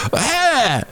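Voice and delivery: high mock yelp at speaking volume; short, high-pitched imitation rock scream. Podcaster imitating a Canadian singer at the microphone, mock scream. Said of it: "would scream into their microphones".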